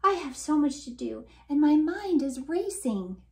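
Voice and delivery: high, rushed busy bee; frantic bee voice. Acted. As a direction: blurt it out like a frazzled bee. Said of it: "frantic look and blurted".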